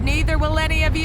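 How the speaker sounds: ordinary talk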